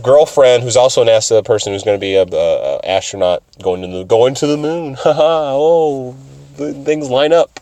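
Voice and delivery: normal talking voice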